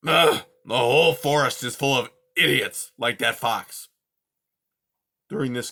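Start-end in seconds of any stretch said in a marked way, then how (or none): 0.1-3.9 put-on loud grumpy old creature voice; cranky old porcupine, louder; gruff old porcupine. Aerith grumbling.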